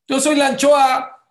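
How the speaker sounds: alto y fuerte, imitando a alguien que se queja; exclamación burlona de víctima; mock self-pity. Mocking a self-pitying person. (burlándose de quien se cree víctima, en voz alta)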